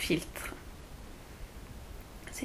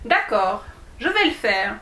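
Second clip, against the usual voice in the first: usual speaking voice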